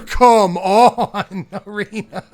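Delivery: high, loud laugh through the words; laughing frustration. Real, not acted. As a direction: laugh it out in disbelief. Laughing at bad luck.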